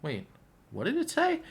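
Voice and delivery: a sudden high squeak of disbelief; surprised double take. Retold or imitated. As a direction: pitch jumps way up in mock confusion.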